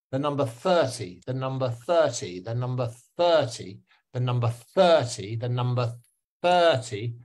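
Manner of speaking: thirty with a punched THIR; THIR-ty, repeated as a drill; stress on first syllable. Shown as a demonstration. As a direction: hit the start of "thirty", let the ty drop. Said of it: "The first syllable".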